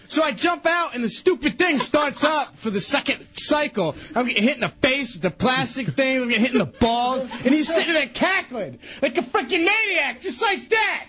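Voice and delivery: high-pitched exasperated rant, building; aggrieved, half-shouted complaint; worked-up indignation. Real, not acted. Indignantly recounts being hit, getting worked up.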